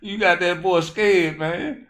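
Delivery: high, loud, laughing through words; laughing at the bit. Real, not acted. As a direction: talk while cracking up. Laughing while talking.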